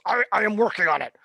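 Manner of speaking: fast, high, stammering reassurance; flustered officer. Acted. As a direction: hurry to reassure the captain. Officer pressed by captain.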